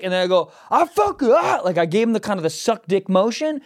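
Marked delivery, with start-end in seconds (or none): 0.7-1.6 high-pitched road-rage retort; mock-furious high comeback; reenacted angry outburst. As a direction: replay a worked-up insult, pitched high. Retelling his angry comeback.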